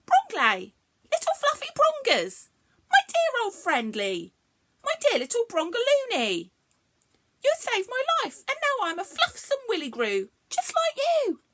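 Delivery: grateful little creature cooing; fond. Acted. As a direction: gush fondly to your rescuer. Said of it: "she smiled and said".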